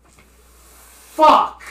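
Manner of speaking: loud gravelly monster yell. Acted. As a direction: bark it out like a goblin. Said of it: "In a really, really gravelly voice".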